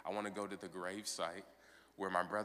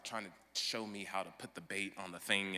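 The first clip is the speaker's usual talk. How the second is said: usual speaking voice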